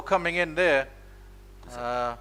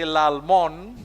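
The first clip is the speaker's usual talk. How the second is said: put-on louder Jamaican-accent Lalmon; 6 dB louder than usual. Mimicking a colleague's Jamaican accent, louder. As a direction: say the name the way Penny does, in a Jamaican accent. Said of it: "in that Jamaican accent".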